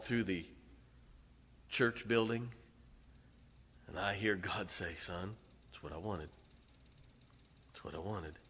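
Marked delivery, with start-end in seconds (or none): 4.9-8.4 soft, gentle voice of a pleased God; tender father. Retold or imitated. Preacher voicing God approving his son, a soft tender father.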